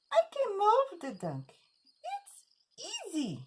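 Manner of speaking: very high, confident little bee voice; boastful bee. Acted. The bee boasts it can move the donkey, boastful. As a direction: sing out the boast high, stretching the last words.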